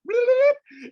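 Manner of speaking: very high, loud trill; cartoon vanishing noise. Storyteller imitating people in suits vanishing, high comic trill. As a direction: imitate a sudden comic zip away.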